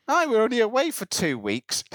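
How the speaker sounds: retold in a Scottish voice; Scottish accent. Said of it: "make sure the accent goes for at least".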